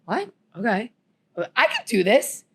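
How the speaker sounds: ordinary delivery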